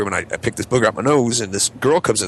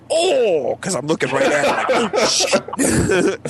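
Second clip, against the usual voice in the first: mortified oh shit; 9 semitones higher, much slower than usual. Retold or imitated. Booger lands on coworker's sock.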